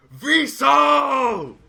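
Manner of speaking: loud, high shout of recognition; joyful shout. Acted. Ogre spots his old wrestling friend and shouts his name joyfully. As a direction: shout the name in glad surprise.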